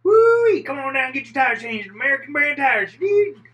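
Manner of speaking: very high, loud hollered tire ad; over-the-top shrieked commercial; sponsor pitch. Acted. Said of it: "the sponsor is like".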